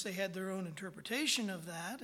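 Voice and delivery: ordinary delivery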